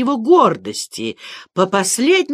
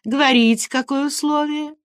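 eager, higher-pitched questioning; curious; 6 semitones higher than usual. A narrator's reading. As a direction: prompt them with lively curiosity. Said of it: "Аглая засмеялась, сказала, блистая любопытными глазами". (с живым любопытством)

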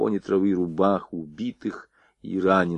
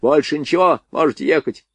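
angry dismissal; 6 semitones higher, 3 dB louder than usual. Acted. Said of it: "вскипевший Фок".

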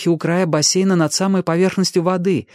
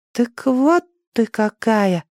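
put-on high, slow, yawning child; sleepy boy; 8 semitones higher, much slower than usual. A narrator's reading. Danny looking at Ali with sleepy eyes, drowsily. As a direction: say it drowsily, about to yawn. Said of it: "сказал он и зевнул".

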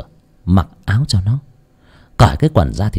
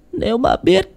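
very high, tearful wail; acted anguished cry over her dead child; mother's grief. A narrator's reading. Grieving mother answers over son's body. (giọng mẹ than khóc, rất cao)

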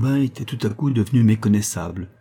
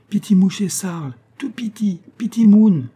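high, urgent Creole character voice; high-pitched, anxious Creole speaker asking after someone; 11 semitones higher, 5 dB louder than usual. A narrator's reading. Companion confiding bad news to the proscrit, high and urgent. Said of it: "lui demanda d'une voix basse".

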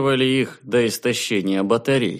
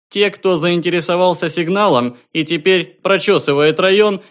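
high, loud official announcement; a distant station calling out formally; formal observer voice; 9 semitones higher, 5 dB louder than usual. A narrator's reading. Observer answers questions.

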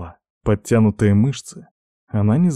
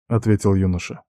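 ordinary delivery, nothing marked

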